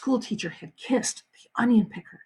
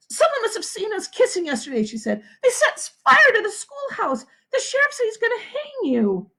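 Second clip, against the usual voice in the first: frantic; 13 semitones higher than usual. A narrator's reading. Catherine frantic.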